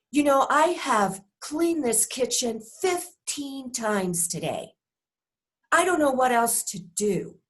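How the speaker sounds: aggrieved nagging at a spouse over the kitchen; blaming frustration. Shown as a demonstration. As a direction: make your partner feel it's all their fault. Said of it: "You can hear the blame".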